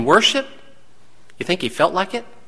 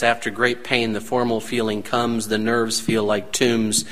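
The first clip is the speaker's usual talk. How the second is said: poem reading; 7 semitones lower than usual. Acted. Reads a poem.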